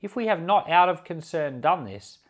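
ordinary talk, nothing marked

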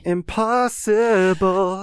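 high, slow, hammed-up disbelief; dramatic movie voice. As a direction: stretch it out like a movie detective. Dramatic cop show line.

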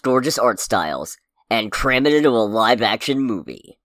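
dramatic trailer narration with weighty pauses; trailer announcer voice. Pretend. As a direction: build it up like a film teaser. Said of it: "I'm gonna try and do my best trailer announcer voice".

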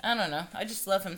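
ordinary talk

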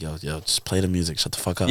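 low, soft, fed-up hush; hushed annoyed. Retold or imitated. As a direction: mutter at him to stop. Friends telling him to stop.

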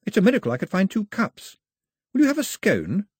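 put-on high, light young girl voice, anxious and polite; a young castaway girl fussing over her guest; girl's voice. A narrator's reading. Girl offering scones to her guest, high and eager.

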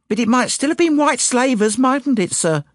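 plain reading voice

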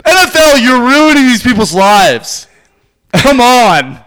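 high, loud, drawn-out protest; hammed-up anguished cry; mock outraged plea. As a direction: plead with the league at full voice.

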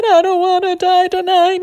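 high, loud scared wail; hammed-up frightened squeal; acted terror. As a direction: wail it like a terrified kid. Terrified kid in acted exchange.

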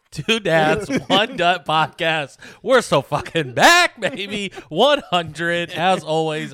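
loud, high hype-host announcement; shouted show intro. Real, not acted. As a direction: open the show at a full, excited shout. Shouted show intro after the song.

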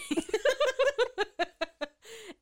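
loud high laugh; high burst of laughter. Real, not acted. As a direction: laugh hard at yourself. Laughs after outburst.

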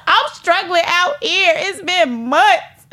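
high, whiny begging through laughter; desperate pleading. Acted. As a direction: beg like you're struggling, half laughing. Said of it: "One is like".

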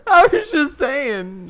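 slow and loud through laughter; laughing. Real, not acted. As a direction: giggle the words out. Laughing at teasing him.

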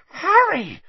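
a sudden high squeal of her name. A narrator's reading. Hermione spotting Harry behind her, squealing. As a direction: shriek his name in startled delight. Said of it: "squealed Hermione".

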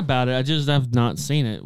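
usual speaking voice

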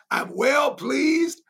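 high, slow ringing refrain; preached refrain. Real, not acted. Preacher quoting God to the church, slow and high. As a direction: stretch it out and lift it high. Preacher repeats God's words.

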